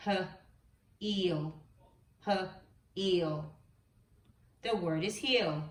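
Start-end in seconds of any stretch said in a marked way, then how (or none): 0.1-3.5 H then eel, split apart; segmented sounds. Shown as a demonstration. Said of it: "Let's do another word".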